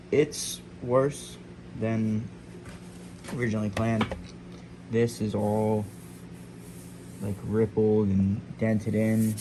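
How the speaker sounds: muffled by a dust mask; through mask. Real, not acted. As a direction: talk through a sanding mask. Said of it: "sorry, I got a mask on my face".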